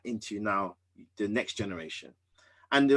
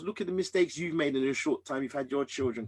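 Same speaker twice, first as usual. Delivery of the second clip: usual speaking voice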